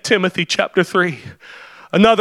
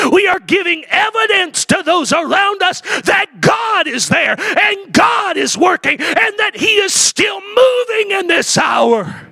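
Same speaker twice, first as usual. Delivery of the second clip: high, ringing preacher's cry; preaching at full force; 8 semitones higher than usual. Acted. Pastor lifting the congregation, preaching at full force. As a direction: lift it up like a revival preacher.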